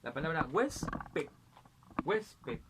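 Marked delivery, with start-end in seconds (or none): 0.5-1.3 huésped said slowly, HUÉS-ped; stress on penultimate syllable. Shown as a demonstration. Teacher showing palabras llanas, huésped stressed on HUÉS. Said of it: "la sílaba tónica en la". 2.0-2.6 huésped in two separate syllables, higher; stress on penultimate syllable. Shown as a demonstration. Teacher repeating the example, huésped stressed on HUÉS. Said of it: "la sílaba tónica en la".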